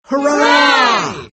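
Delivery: the crowd cheers the patriots in the parade, shouting. A narrator's reading. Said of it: "Everyone shouted".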